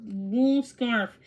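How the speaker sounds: ordinary delivery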